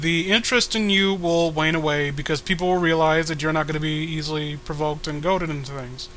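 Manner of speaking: ordinary talk, nothing marked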